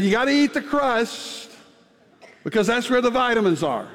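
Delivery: lightly voiced mother laying down the rule; a hint of a firm mother insisting; imitating mother. Mother's rule quoted to child.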